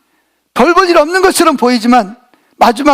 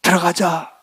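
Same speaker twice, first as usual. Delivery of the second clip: low, soft coaxing to come inside; father soothing his angry older son; 6 semitones lower, 7 dB quieter, slower than usual. Retold or imitated. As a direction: gently talk the sulking son round. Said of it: "막 달랩니다".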